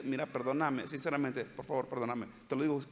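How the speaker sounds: low, soft, heartfelt apology; low and soft, a sincere sorry; sincere plea. Retold or imitated. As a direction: beg forgiveness gently from the heart. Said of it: "le dice".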